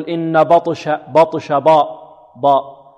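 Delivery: بطش with the ب made heavy and dark; heavy ba demo. Shown as a demonstration. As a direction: darken the ب in بطش as the wrong way. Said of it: "البعض ربما يفخم الباء". (ترقيق خاطئ: تفخيم الباء في بطش)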